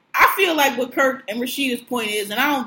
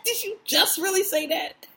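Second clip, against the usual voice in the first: laughing disbelief; 8 semitones higher, 6 dB quieter than usual. Retold or imitated. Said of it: "Mimi's face was just like".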